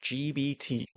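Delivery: "G B T" mockingly echoed as gee bee tee; "G B T" repeated with a B to mock him. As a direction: parrot back "G B T" with a B, teasing. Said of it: "Okay, he said it again".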